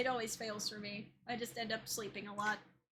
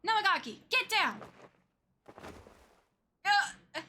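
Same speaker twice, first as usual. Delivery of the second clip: louder, higher clipped battle cries; heated fight lines; 6 semitones higher, 8 dB louder than usual. Acted. Heroes seizing the curse stone, excited cries. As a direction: play the scramble for the curse stone.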